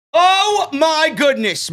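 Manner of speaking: high, drawn-out amazed exclamation; astonished. Real, not acted. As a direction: let a big donation knock you back, pitch leaping up. Reacts to another big donation.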